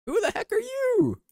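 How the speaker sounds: very high cartoon squeal. Mock-cute squeal at photo.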